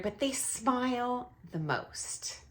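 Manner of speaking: soft, slow whisper, letting them in on it; hushed aside; confiding whisper. Real, not acted. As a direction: drop to a whisper like sharing a secret. Confiding aside after "sound weird.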